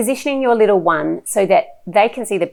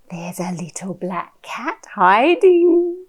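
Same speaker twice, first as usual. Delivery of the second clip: hushed wonder; 3 semitones higher, 3 dB louder, slower than usual. Shown as a demonstration. Hushed secret for a child.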